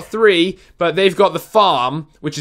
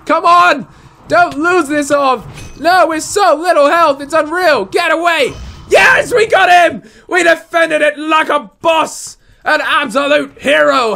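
triumphant shouting; 9 semitones higher than usual. Real, not acted. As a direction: scream with real excitement as you win. Winning close fight, cheering.

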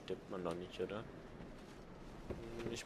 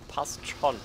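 higher, louder, firmly settled; emphatic reassurance; 5 semitones higher, 6 dB louder than usual. Shown as a demonstration. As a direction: say it like you mean it. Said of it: "repeated with emphasis". (nachdrücklich beruhigt)

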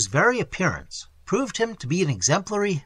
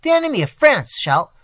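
higher, louder, bursting with his idea; loud eager; 7 semitones higher, 7 dB louder than usual. A narrator's reading. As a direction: boast it loudly to the crowd. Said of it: "chattered faster and louder than the rest".

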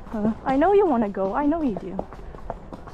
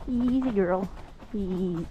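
soothing a horse; 3 semitones lower, 7 dB quieter than usual. Real, not acted. Calming an excited horse.

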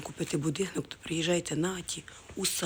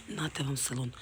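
normal talking voice

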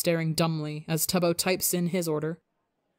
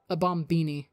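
ordinary delivery

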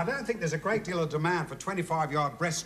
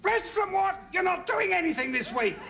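exasperated outburst; 12 semitones higher than usual. Acted. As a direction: explode in disbelief. Exasperated interviewer erupts.

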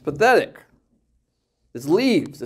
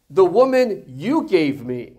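slow, raised, pointed accusation; mock blaming; 4 semitones higher, 5 dB louder, slower than usual. Adam blames God for his wife, mock blaming. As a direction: throw the blame back, drawn out. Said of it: "He blames God. He says".

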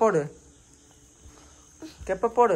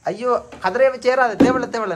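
alarmed warning; 7 semitones higher, 4 dB louder than usual. Real, not acted. Child climbing on a chair.

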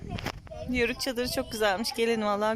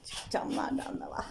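whisper; 7 dB quieter than usual. Pretend. Spooky tour whisper continues.

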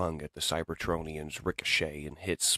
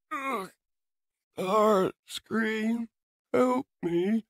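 wounded robot; 3 dB louder, much slower than usual. Acted. Battered robot begs for help.

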